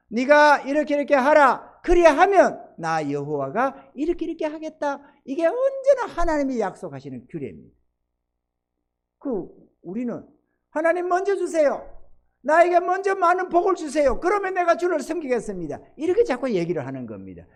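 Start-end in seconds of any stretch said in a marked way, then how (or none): 0.1-7.8 loud, high pulpit proclamation; ringing preacher's declaration; forceful preaching. Acted. 10.7-15.8 high, loud pleading to God; high-pitched mock prayer of demand; demanding petitioner. Acts believers demanding blessing first.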